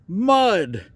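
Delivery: plain reading voice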